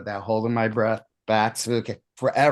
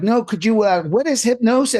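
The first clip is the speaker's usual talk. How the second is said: high, loud pestering imitation; eager questioning; 11 semitones higher, 5 dB louder than usual. Dinner guests beg him to explain hypnosis, eager. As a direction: mimic keen, piled-on questions.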